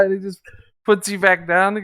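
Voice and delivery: ordinary talk, nothing marked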